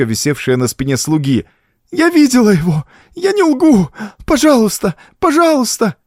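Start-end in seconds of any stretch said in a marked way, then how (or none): 1.9-6.0 put-on very high, wailing child's plea; crying through tears. A narrator's reading. Girl being whipped begs the count, tearful cries. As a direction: beg shrieking and tearful. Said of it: "сквозь слёзы кричала девочка".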